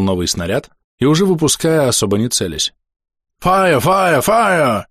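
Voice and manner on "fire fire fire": high repeated English battle cry; shouted from afar. A narrator's reading. British sailors shouting from the whaleboats. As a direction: call out the order from the boats. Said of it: "закричали с вельботов".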